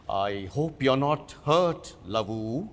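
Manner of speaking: gentle concern. Acted. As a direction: soothe her quietly. Director comforts young actress.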